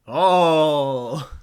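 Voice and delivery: loud startled exclamation. Real, not acted. Streamer reacting loudly to the AI. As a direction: burst out with oh.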